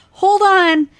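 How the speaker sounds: high, loud warning shout; acted yell. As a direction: shout it out to brace the passengers.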